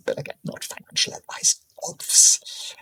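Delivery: whispered aside. Real, not acted. Trader whispers a disclaimer to viewers, hushed whisper.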